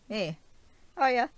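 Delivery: put-on high, loud take on Stephen's accent. Vlogger quoting Stephen called out by Arthur, imitated accent, high-pitched. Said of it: "I love his accent".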